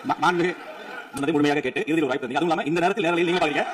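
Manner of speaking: ordinary talk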